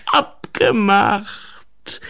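gedehnte, hohe Gnomenstimme; Waldgnom. Acted. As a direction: schlag sehr langsam und genüsslich ein. (gedehnter Gnomenton)

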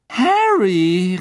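high, loud, drawn-out greeting; gushing, stretched-out hail; beaming call. A narrator's reading. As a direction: cry the name out with a big smile. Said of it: "rief sie und setzte ein strahlendes Lächeln auf".

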